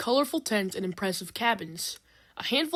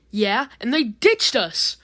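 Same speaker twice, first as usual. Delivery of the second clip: yelled; 3 semitones higher, 7 dB louder, slower than usual. A narrator's reading. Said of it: "Drill yells".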